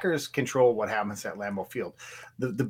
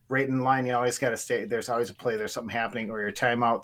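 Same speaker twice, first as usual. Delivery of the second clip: ordinary delivery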